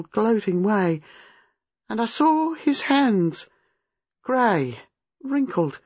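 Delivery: put-on high, halting, traumatised young woman; haunted recollection rising to high distress; horrified. A narrator's reading. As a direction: relive it in fragments, building to anguish. Reliving seeing a strangled woman.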